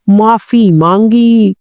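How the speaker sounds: ordinary delivery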